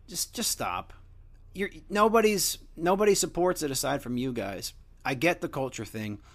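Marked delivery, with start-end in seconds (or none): none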